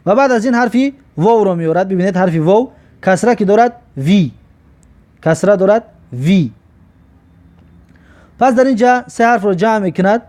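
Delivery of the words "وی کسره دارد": ordinary talk, nothing marked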